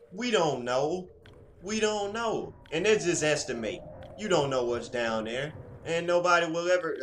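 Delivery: high, loud emphatic insisting; excited. Real, not acted. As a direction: hammer home the unknown. Excited rant about the ocean.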